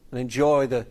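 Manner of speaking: usual speaking voice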